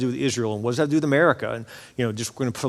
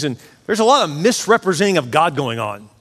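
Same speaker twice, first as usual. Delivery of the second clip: ordinary talk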